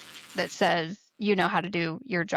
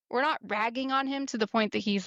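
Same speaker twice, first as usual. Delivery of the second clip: usual speaking voice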